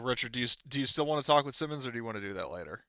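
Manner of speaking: usual speaking voice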